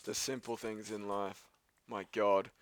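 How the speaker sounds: usual speaking voice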